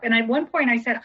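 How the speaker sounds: usual speaking voice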